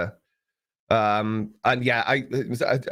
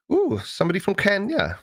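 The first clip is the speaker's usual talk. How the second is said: delighted; 9 semitones higher than usual. Real, not acted. Greets a viewer from Kenya.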